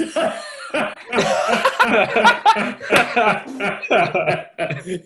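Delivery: loud helpless laughing fit; real, loud laughter; long burst of laughter. Real, not acted. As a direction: crack up hard and keep laughing. Friend's joke about his looks.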